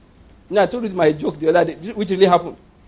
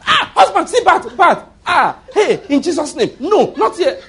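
much higher, panicky wife's cry; fear; 9 semitones higher, 3 dB louder than usual. Retold or imitated. Husband imitating his wife's imagined panic over a bat. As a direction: squeal it like a scared wife.

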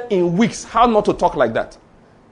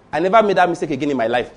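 usual speaking voice

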